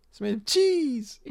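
high, slow mock-gleeful squeal; gleeful mimicry. Co-host mimicking how gleefully it was said, gleeful. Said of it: "You say that so gleefully".

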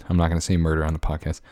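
usual speaking voice

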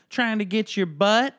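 put-on high, loud little-boy voice; a six-year-old answering the father without shame; cheeky little kid. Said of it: "the kid's like".

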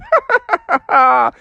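high gleeful cackle; high mischievous cloud laugh. Acted.

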